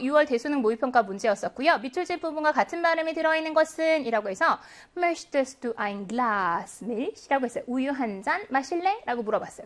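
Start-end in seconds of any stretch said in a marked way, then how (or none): 5.0-7.3 slow German read. Shown as a demonstration. Teacher reads German example question.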